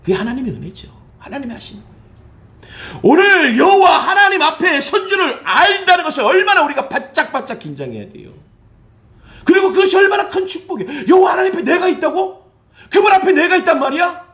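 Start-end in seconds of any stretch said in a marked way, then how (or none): 3.0-8.3 loud, high shouted sermon line; fiery preaching. Acted. Pastor shouting to the congregation, fiery preaching. 9.4-14.3 high, loud amazed exclamations; impassioned preaching. Acted. Pastor marveling before the congregation, impassioned preaching.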